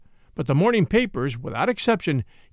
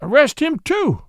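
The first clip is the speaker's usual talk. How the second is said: higher, louder demand; 8 semitones higher, 5 dB louder than usual. Acted. Watson demanding of the officer, louder. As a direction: insist on it. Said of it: "Watson demanded".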